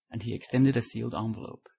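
ordinary delivery, nothing marked

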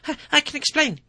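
a cornered man begging to be heard; desperate. Acted. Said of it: "he said desperately".

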